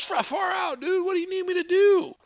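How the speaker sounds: high-pitched mock stoner voice; stoner guy. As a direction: play an amazed stoner, voice way up. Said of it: "he gets the stoner guy".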